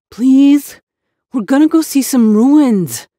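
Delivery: put-on high, loud childish begging; pleading. Boy begging his aunt, high loud plea. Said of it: "Humpy whined".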